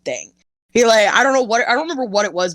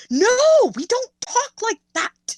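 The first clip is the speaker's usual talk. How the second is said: mock stern mom, much higher and slow. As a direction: scold a two-year-old in a sing-song way. Mom correcting her toddler's swearing.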